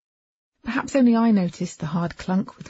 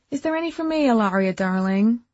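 put-on sugary young woman; 5 semitones higher, 5 dB louder than usual. A narrator's reading. Tab voiced, sugary.

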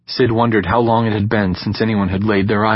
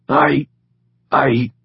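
stuttering; 5 semitones higher than usual. A narrator's reading. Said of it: "Knox stuttered".

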